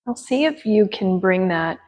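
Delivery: gentle yoga teacher guiding breath and attention; guided meditation. Acted. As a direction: ease them into stillness with long pauses.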